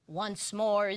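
ordinary delivery, nothing marked

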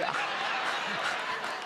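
breathy laugh at his own joke; a real chuckle at the punchline. Real, not acted. Pastor laughing at his own [name] joke, real chuckle. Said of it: "I thought you'd like that".

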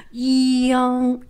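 "Yang" held long on a high level pitch; long, sustained "Yang"; one syllable drawn out. Shown as a demonstration. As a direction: stretch "Yang" slowly from y into the vowel. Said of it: "It's one syllable".

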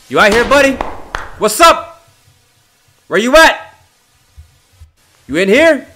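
loud, high-pitched call into the dark. Real, not acted. Player calls out to the demon, loud and high. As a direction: shout to find who's out there, rising at the end.

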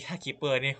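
ถ้าคิปเปอร์นี่ said between giggles; laughing restart. Real, not acted.

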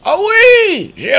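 high, loud cry of triumph; triumphant cry. Real, not acted. Gamer cheers after picking the lock. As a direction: crow with delight.

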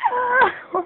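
high loud yelp of alarm; startled cry. Real, not acted. Gamer caught in hide and seek, yelping. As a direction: yelp as you get caught.

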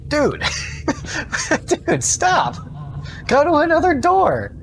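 high, frantic yelling at the monster; startled shouting, real panic; panicked yell. Real, not acted. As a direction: yell at it to go away, half laughing.

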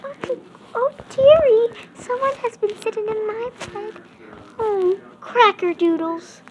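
high, fast, hushed mama bear. Acted. Child narrator voicing mama bear, high and hushed. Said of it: "the mama bear said".

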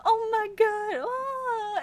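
high, slow tearful gasp; crying with joy, voice breaking; happy crying. Real, not acted. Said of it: "I can't stop crying".

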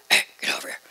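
soft voiceless whisper; loving whisper. Retold or imitated. Daughter imitating her loving father's whisper. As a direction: whisper it fondly. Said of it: "If he was loving".

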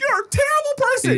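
high squeaky mock outrage; weird angry outburst. Yelling at the cab driver in a squeaky high voice. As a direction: shriek it in a silly high put-on voice. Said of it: "I say really weird things when I get angry".

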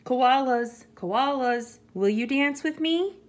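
high, eager mouse invitation; little mouse calling. A narrator's reading. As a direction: call the animal, then lift into the question. Mouse calls to an animal.

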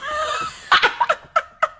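high mocking laugh; burst of laughter. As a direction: cracks up laughing at the poem. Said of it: "It's brilliant".

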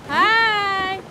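high, very loud, eager greeting; big high-pitched shout; calling out hello. Real, not acted. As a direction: holler hello to get their attention. Calling to an unresponsive character.

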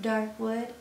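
ordinary delivery

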